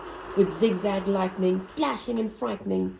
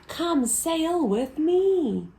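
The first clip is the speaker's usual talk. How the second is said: whale's invitation; 8 semitones higher, 3 dB louder, much slower than usual. A narrator's reading. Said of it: "and said to the snail".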